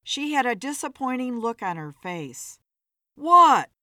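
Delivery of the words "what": loud, raised, jumpy question; nervous, louder one-word question. A narrator's reading. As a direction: blurt it out nervously. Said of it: "Philip asked nervously".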